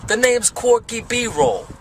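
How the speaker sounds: slow, high hammed-up Jersey accent. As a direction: play a bad Jersey tough guy. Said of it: "Jersey. From Jersey".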